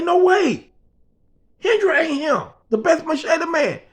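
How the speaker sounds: a boss who can't believe the job is done; shocked disbelief. Retold or imitated.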